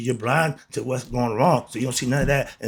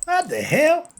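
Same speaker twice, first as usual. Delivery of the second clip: much higher, exasperated what the hell; incredulous outburst; 13 semitones higher, slower than usual. Real, not acted. Mocking Bible followers' claims, an incredulous high outburst. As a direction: throw up your hands in disbelief.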